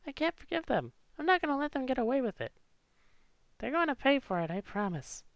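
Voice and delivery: put-on high, soft but determined girl; vengeful girl. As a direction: swear revenge through grief. Voicing Clover, vowing revenge.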